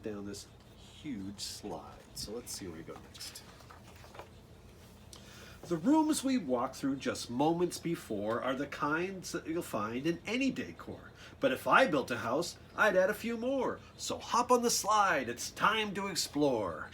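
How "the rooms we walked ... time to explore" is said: lively, sing-song storybook read; bouncy picture-book reading voice; reading to children. Acted. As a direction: bring the rhymes alive for the kids. Reads the rhymed book text.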